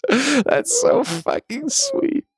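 high, drawn-out melting reaction; airy, high-pitched awe; touched gushing. Real, not acted. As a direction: gush slowly, really moved by the sweetness. Touched by story of son.

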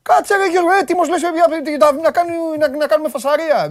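high-pitched mock protest; high, agitated retelling of a confrontation; imitating indignant player. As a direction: play a player itching for a fight, pitch up high. Acting out the player's reaction.

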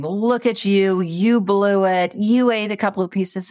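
mock the judging voice in your head; critical inner voice. Coach recalls fridge moment, voicing the critical inner voice. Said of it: "there was this very critical voice saying".

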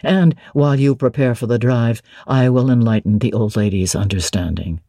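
low, assured nobleman addressing Jane; Rochester. Acted. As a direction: speak as the commanding master of the house. Rochester voiced low by reader.

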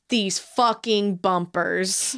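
slow exasperated. Retold or imitated. Exasperated note on show bumpers.

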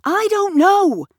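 high, loud, defensive protest. A narrator's reading. Gavin is put on the spot by Kat, defensive. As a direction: fend off the question indignantly. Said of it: "says Gavin defensively".

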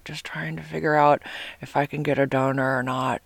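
hushed, whispery imitation; imitating her date's voice. Sick date explains his donor search, imitated whispery voice. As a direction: murmur it as the odd-sounding date. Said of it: "this is sort of how he talked".

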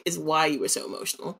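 ordinary talk, nothing marked

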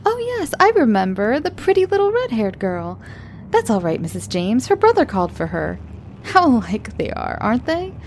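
high, cheerful chatty teacher voice; breezy and sweet, all smiles; bright young teacher. Acted. Young teacher chatting brightly to the late mother. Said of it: "said brightly".

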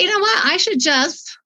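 fighting back real tears; choked up, emotional; tearful. Real, not acted. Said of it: "she makes me cry talking about it".

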